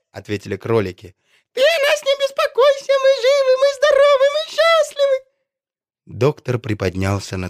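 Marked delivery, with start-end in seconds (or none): 1.5-5.2 very high, loud squeaky rabbit voices; tiny rabbits. A narrator's reading. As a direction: pipe up like little bunnies. Rabbits reassure doctor.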